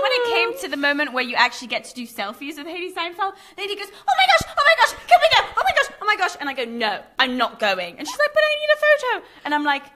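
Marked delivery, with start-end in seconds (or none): 3.9-6.4 very high, squealed repetitions; mock fangirl panic. Imitating Lainey begging to go for selfies, squealing. 6.6-8.0 slow, deliberate no; retold refusal. Friend refuses to go for the selfie, slow firm no. 8.3-9.2 very high-pitched whine; mock pleading. Imitating friend who needs a photo, high pleading.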